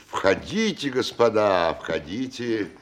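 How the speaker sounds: ordinary talk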